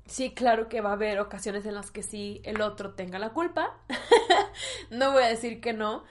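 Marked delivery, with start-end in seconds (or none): 3.8-4.8 short high burst of laughter; quick high giggle. Real, not acted. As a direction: laugh lightly at yourself. Laughing at a remark.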